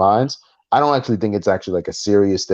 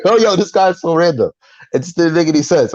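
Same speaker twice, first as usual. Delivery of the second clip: amused disbelief; 6 semitones higher than usual. Retold or imitated. Amused disbelief after laughing.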